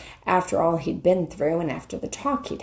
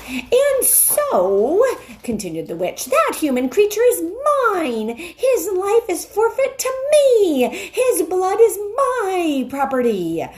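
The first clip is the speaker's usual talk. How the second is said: much higher, louder witch with a cruel laugh; gloating witch; 12 semitones higher, 5 dB louder than usual. Acted. The witch gloating over Edmund's forfeit life. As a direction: cackle as you claim him.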